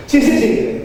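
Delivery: high, insistent summons; urgent beckoning. Retold or imitated. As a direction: call her over at once. Beckoning his wife, retold. (menyuruh mendekat dengan nada tinggi)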